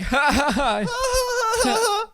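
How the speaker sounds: high, slow forced laughing; fake laugh. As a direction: laugh along without hearing a word. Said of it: "And I'm just like".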